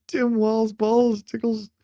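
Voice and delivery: high giggly squeal; laughing while talking. Real, not acted. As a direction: giggle through the words. Laughing reaction to clip.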